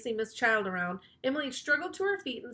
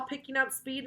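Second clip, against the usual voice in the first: usual speaking voice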